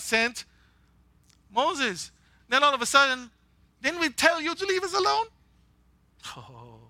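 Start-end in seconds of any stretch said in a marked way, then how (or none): 3.8-5.3 indignant high-pitched complaint; angry. Acted. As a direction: throw the Israelites' grumbling back, heated. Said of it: "I'm getting angry again".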